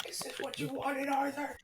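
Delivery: high, eerie whisper; creepy whisper. Acted. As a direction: whisper it like a sinister ghost. Villain whispers to Arthur.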